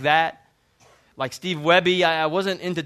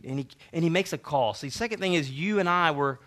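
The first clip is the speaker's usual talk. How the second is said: usual speaking voice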